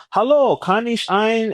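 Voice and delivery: high, slow, hesitant beginner German; nervous German learner. Retold or imitated. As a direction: fumble the order like an unsure learner. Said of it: "you kinda nervous".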